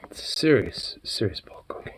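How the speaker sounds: unvoiced whisper. Real, not acted. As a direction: whisper the joke. Repeats the joke in a whisper.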